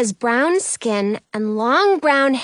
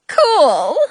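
loud, higher delighted outburst; exclaiming; 4 semitones higher, 6 dB louder than usual. A narrator's reading. Rumpelstiltskin has just been knighted, exclaiming. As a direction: exclaim it with boyish glee. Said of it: "Rumpelstiltskin exclaims".

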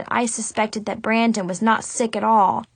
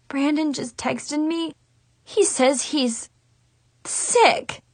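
tearful mumble; 3 semitones higher, slower than usual. A narrator's reading. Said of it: "I muttered".

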